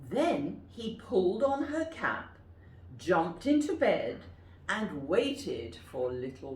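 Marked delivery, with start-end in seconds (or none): none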